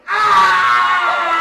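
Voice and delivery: loud high cry of pain; pained yell. Retold or imitated. Pacino after falling on the floor, crying out in pain. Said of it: "on the floor going".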